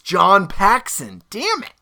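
loud, high slow groan of realization; frustrated exclamation. Real, not acted. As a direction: kick yourself for getting it wrong.

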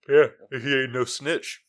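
slow words through a yawn. Real, not acted. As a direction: talk while yawning. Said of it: "Did you just yawn?".

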